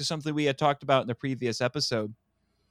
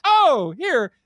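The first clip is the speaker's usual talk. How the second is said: coaxing dog voice; 6 semitones higher, 4 dB louder than usual. Retold or imitated. Mad scientist luring stray dog.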